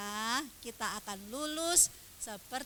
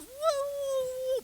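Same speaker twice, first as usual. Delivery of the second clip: long, high mock wail; imitated crying; 5 dB louder than usual. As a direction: bawl on one drawn-out cry. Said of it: "dia nangis".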